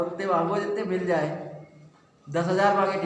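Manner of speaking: ordinary talk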